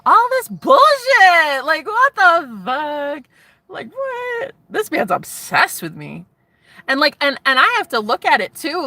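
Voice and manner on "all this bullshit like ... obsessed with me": high, loud scoffing disbelief; incredulous outburst. Real, not acted. To her viewers, scoffing at her own fears, high and disbelieving. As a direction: scoff at your own silly doubts.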